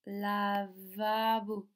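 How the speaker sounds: "lavabo" drawn out slowly, leaning on va; stressed middle syllable. Shown as a demonstration. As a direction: stretch la and va in "lavabo", then bo. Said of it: "Regardez, va".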